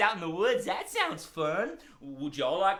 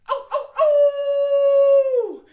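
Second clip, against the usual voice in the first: high, loud, long howl; two short yelps then a long swelling howl; big hound dog howl; 14 dB louder, much slower than usual. Pretend. Dog rousing children for the woods, big howl. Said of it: "give me a big hound dog howl".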